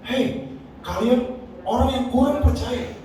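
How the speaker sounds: high, slow scolding with a laugh in it; hammed-up rebuke, half laughing; mock scolding Jesus. Preacher parodies Jesus scolding the disciples, slow mock rebuke. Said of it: "Yesus marah".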